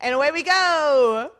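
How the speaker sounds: loud, drawn-out catchphrase; called-out sign-off. Pretend. Co-host closing the episode after lights out, called-out sign-off. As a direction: send the show off with a big call.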